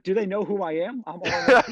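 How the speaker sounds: high boastful dad, laughing through it. Retold or imitated. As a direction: boast it while cracking up. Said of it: "he's like".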